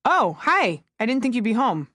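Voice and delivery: ordinary delivery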